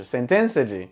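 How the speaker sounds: sentenced said a-sen-ten-sa-dji; mock the mangled four-syllable sentenced. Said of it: "Ah, quatro sílabas".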